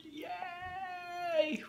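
long, high, very soft yay; muted cheer. Real, not acted. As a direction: cheer under your breath, held high. Muted cheer in sleeping house.

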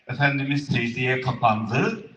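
ordinary talk, nothing marked

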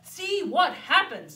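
boasting about city learning; proud papa bear. Acted. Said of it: "said Papa".

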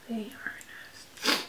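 hushed whisper. Real, not acted. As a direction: whisper it aside. Whispered aside.

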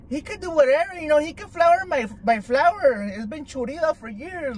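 high-voiced, keen grandma act; excited abuelita. Abuelita listing what the viejito could do, imitated as excited. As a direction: imitate an eager granny, pitched high. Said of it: "She was all excited".

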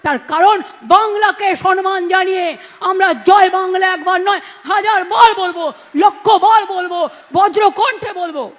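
loud rousing slogan shout; fiery rally cry. Real, not acted. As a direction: roar it to the crowd. Rally slogan, building to a roar.

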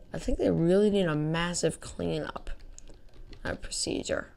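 ordinary talk, nothing marked

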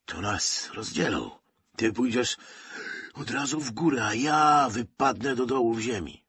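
feverish breathy dread, pitch climbing as it goes; acted breathless urgency in a friend's ear; feverish panting. A narrator's reading. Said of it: "wydyszał prosto w jego ucho".

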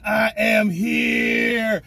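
drawn-out announcement. Real, not acted. Drawn-out hype intro.

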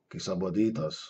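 low, soft doubting question; dragon's huff. A narrator's reading. Caged dragon doubts the man's offer, huffing low. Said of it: "fújt egyet a sárkány".

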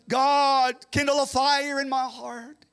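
loud, high cry to God; fervent prayer. Real, not acted. Preacher crying out for God to light his heart.

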